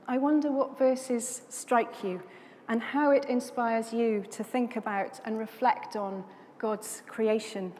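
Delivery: usual speaking voice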